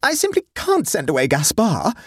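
high-pitched wail of protest. A narrator's reading. Hostess refusing to lose her chef, high wail. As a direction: wail it in distress. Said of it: "wailed Sophie".

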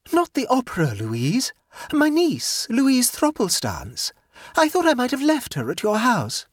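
a hint of a pleased, scatterbrained aunt; light, self-satisfied chatter; gaily. A narrator's reading. Jane's voice.

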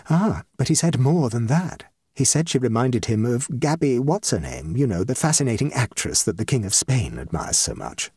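lightly voiced drawing-room chatter; chattering society ladies. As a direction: play a lady trading social news.

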